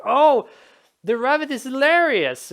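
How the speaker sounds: high, loud mock delight; delighted player. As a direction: act out a player charmed by the joke. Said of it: "and it's like".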